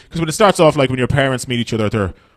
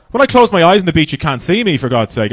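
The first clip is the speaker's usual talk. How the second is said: normal talking voice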